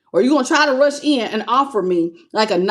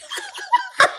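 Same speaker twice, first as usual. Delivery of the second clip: a burst of high, helpless laughter; high squealing laugh; 3 dB quieter than usual. Real, not acted. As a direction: cackle high and breathless. Reader cracks up at card.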